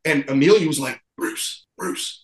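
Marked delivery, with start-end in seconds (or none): 1.2-2.2 hushed urgent whisper. Retold or imitated. Teen girl whispering to point out Bruce at the bar. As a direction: whisper so he won't hear.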